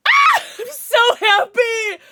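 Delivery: high, loud, slow cry of joy half laughing; tearful happy shout. Real, not acted. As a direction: shout it close to happy tears. Overjoyed about the pet.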